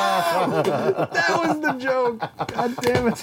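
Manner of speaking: high, drawn-out wail through laughter; laughing protest. Real, not acted. Host whose punchline was stepped on, high laughing protest. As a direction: protest helplessly while cracking up.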